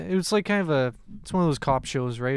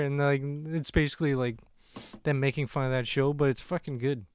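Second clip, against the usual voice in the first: ordinary delivery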